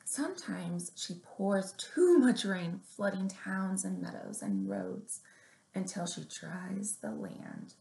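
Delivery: gentle picture-book read-aloud; story reading. Acted. Librarian reading a picture book to little kids, gently.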